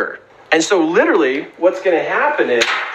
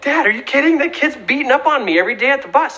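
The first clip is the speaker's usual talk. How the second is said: incredulous son; 9 semitones higher, faster than usual. Retold or imitated. Son protests to dad.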